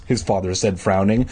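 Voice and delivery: ordinary delivery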